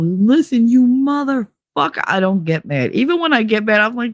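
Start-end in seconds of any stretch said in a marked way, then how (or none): 0.0-2.0 higher, drawn-out mock belligerence; mock angry drunk. Drunk picking a fight, mock angry. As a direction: play a drunk starting a fight, dragging the swear. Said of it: "I don't do the whole".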